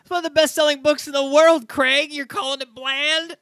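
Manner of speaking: high, loud disbelief; can't believe what he just heard; incredulous. Real, not acted. Host shocked by calling Bible bland.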